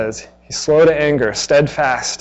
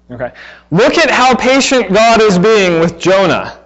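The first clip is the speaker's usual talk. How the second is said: ordinary talk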